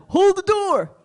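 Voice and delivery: a high yell of Hold the door; distant shout. Retold or imitated. Someone yelling for the elevator.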